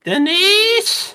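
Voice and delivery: high yelled name; calling out. Pretend. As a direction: shout the name across the house.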